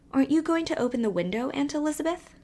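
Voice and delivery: put-on high, quick pleading child voice; child's plea. A narrator's reading. Emily begs her aunt to open the window, high and quick.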